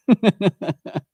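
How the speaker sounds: high, loud burst of laughter; high real laugh. Real, not acted. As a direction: crack up at your own bad habit. Laughing at his own habit.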